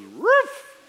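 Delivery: high yappy mock bark; mock dog bark. Pastor imitating the zoo dog's bark, high mock bark.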